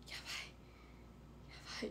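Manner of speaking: breathless whisper, very slow; hushed panic to the girl next to her; retold whisper in class. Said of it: "小声で言ってて".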